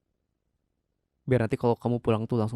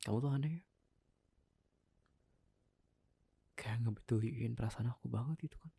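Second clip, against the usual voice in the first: hurt murmur; 13 dB quieter than usual. Acted. Wife hurt, withdrawing.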